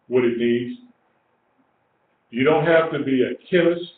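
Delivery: ordinary talk, nothing marked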